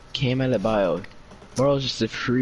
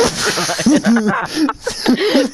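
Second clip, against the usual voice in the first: high, loud laughing words; 13 semitones higher, 6 dB louder, faster than usual. Real, not acted. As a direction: crack up while calling it. Laughing at the play.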